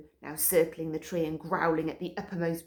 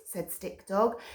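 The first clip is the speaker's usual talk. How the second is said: ordinary delivery, nothing marked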